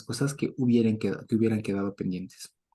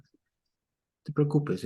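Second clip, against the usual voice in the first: reassuring; 4 semitones higher, much slower than usual. Acted. God soothing Elijah.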